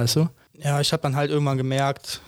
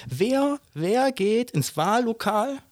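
much higher, slow, stretched-out disbelief; incredulous; 8 semitones higher, much slower than usual. Real, not acted. Ranting to his friend about voters, high incredulous. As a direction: drag it out as if it's absurd.

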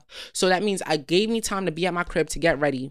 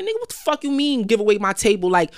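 raised, heated retort; higher, louder outraged comeback; retold outrage; 7 semitones higher, 5 dB louder than usual. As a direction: fire back at the promoter, higher and louder. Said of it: "And I'm like".

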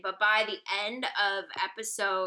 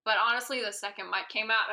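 ordinary talk

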